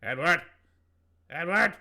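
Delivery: loud, rising call. Acted. Gnome knocking at a silent door, calling out. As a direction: holler the name to get an answer.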